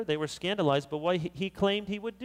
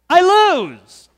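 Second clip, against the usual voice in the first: much higher and far louder mock squeal; mocking shriek; 12 semitones higher, 15 dB louder than usual. Followers mocking the candidate's words, shrieking. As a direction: squeal it in disbelief.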